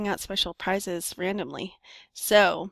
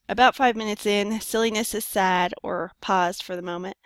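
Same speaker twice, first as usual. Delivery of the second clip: ordinary delivery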